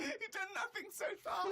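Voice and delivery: high, wheezing words through laughter; teasing through tears of laughter; crying with laughter. Real, not acted.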